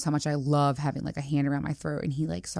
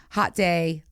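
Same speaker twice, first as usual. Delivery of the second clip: usual speaking voice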